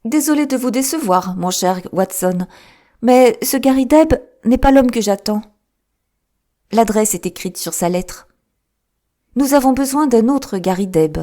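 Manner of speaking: a hint of the keen detective, pleased with a puzzle; Holmes, lightly voiced. A narrator's reading. Holmes teasing Watson about the odd case, lightly voiced.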